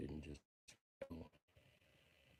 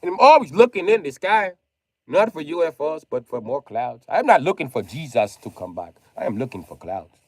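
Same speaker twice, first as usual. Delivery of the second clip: normal talking voice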